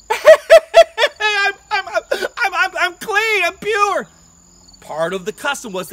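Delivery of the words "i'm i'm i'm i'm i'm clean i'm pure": joyful laughing. Acted. Healed man laughing joyfully.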